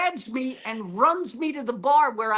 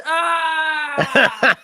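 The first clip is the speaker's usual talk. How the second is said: crowd groan imitated; 2 semitones higher, 5 dB louder than usual. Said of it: "The entire place goes".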